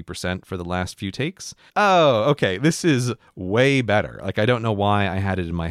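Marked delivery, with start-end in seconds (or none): 1.8-4.2 higher, louder retold relief; relieved realisation. Podcaster replaying their reaction to the slowed-down takes, relieved realisation. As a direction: act out realising it finally works, drawing out "way better".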